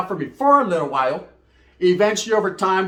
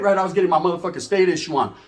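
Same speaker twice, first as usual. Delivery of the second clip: usual speaking voice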